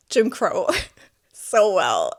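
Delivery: incredulous laugh breaking into the words; real laugh of disbelief, slowing the words; laughing in disgust. Real, not acted. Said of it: "I'm laughing because it's so disgusting".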